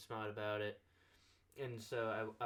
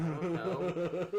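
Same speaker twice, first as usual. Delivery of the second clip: laughing; 6 semitones higher, 8 dB louder than usual. Retold or imitated. Laughing shrug in retold quote.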